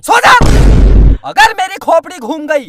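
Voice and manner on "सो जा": angry shout. Acted. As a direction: bark it: go to sleep!. Angry father shouting at night. (गुस्से में चिल्लाकर)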